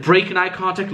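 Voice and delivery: usual speaking voice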